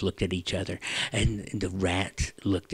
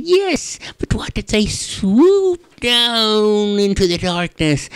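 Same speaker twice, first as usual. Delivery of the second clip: high, squeaky worried mouse; field mouse voice; 11 semitones higher, 7 dB louder than usual. Acted. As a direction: fret like a tiny mouse.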